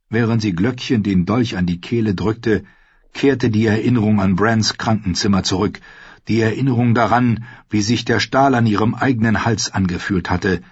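ordinary delivery

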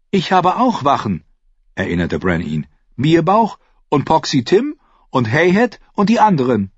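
put-on a boy clinging to his guards; boy Bran. A narrator's reading. Bran reminds Jojen of his guards.

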